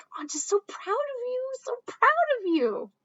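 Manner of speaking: high gushing pride; excited proud parent. Acted. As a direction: gush over your child's school work. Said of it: "if a parent is feeling really excited".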